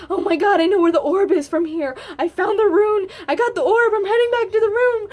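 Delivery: loud, high, breathless triumph; reenacted joyful screaming. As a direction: yell it all out in victory. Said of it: "I was, like, screaming".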